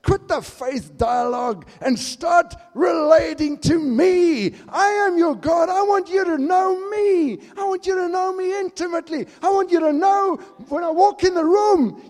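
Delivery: loud, high, impassioned plea in God's voice; fervent voice of God calling his church; God pleading. Acted. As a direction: call the church back like a longing God. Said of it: "He's saying".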